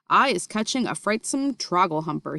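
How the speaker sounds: ordinary delivery, nothing marked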